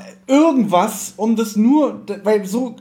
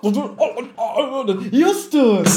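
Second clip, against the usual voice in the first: slow, higher, laughing mock puffing entrance; strained entrance; 5 semitones higher, much slower than usual. Mocking fat Justus squeezing through the door, slow strained puffing. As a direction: drag each und out like someone squeezing through a door.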